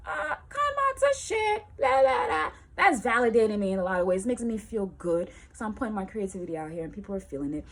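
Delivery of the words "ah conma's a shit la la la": high, loud gushing fan voice; excited fan rave. Retold or imitated. Crowd raving about her show, gushing and high. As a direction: gush like a fan who loves her. People praising her shows.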